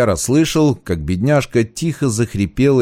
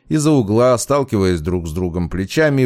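usual speaking voice

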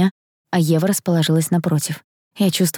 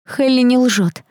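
ordinary delivery

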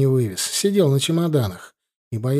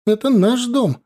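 high-pitched mild yell; acted young woman's cry; shouted claim; 10 semitones higher, 4 dB louder than usual. A narrator's reading. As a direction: call it out on the run, high and raised. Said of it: "закричала молодая на бегу".

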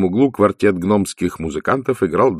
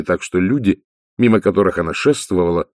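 ordinary delivery, nothing marked

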